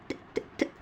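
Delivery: ت ت ت as crisp breathy voiceless taps; isolated voiceless ت ت ت taps; voiceless t sounds. Shown as a demonstration. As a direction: tap out ت ت ت without voice. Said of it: "أنطقها عند الوقف".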